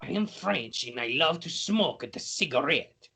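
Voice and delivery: a French penguin who loves his cigarette; mock French accent. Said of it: "it's racist when I talk like this".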